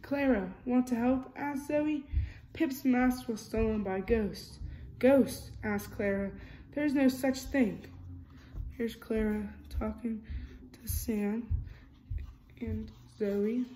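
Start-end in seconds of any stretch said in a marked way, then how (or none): 0.0-7.9 lively storybook reading, character lines a bit louder; reading to children. A narrator's reading. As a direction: read it like a bedtime picture book. Reading picture book aloud.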